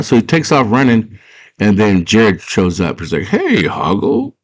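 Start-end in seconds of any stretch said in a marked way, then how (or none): 3.2-4.3 sly greeting. Retold or imitated. Jareth greets Hoggle, acted.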